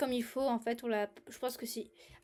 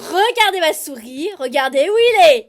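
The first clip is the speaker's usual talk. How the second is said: excited shouting; 4 semitones higher, 13 dB louder than usual. Real, not acted. Pointing at horror monster.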